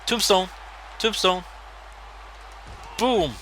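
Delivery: excited shouting. Real, not acted. Gamer hypes his Tombstone finisher, shouting. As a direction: yell it like the crowd just popped.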